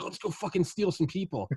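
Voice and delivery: high put-on prankster voice; mischievous alien. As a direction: pitch it up like a giddy prankster. Voicing aliens cow tipping.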